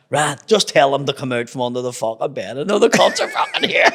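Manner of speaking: retold outburst building to a high, loud yell; mock angry dad. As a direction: start firm, then climb into a laughing shout. Acts out angry dad, building to shout.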